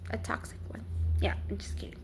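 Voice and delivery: soft whispered joke; whispered joke about a toxic relationship; whispered aside. Real, not acted.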